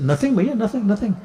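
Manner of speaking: put-on high, quick boyish denial; innocent child denial. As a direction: play the caught little boy. Child Krishna denying to mother.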